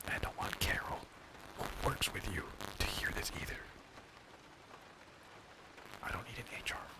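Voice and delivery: hushed secretive whisper. Acted. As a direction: whisper it so nobody overhears. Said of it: "I have to whisper this one".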